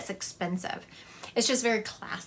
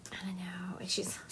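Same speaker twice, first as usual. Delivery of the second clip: whispered aside; 10 dB quieter than usual. Real, not acted. Aside while reading spirits.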